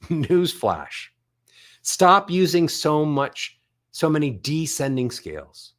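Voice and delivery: ordinary talk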